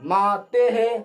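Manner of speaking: माते है drawn out loudly, "maa-te-he"; visarga echo. Shown as a demonstration. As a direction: project माते है slowly, final "he" echoing the vowel. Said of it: "विसर्ग का उच्चारण हो जाएगा एक जैसा". (माते है के अंत में ज़ोर से "हे")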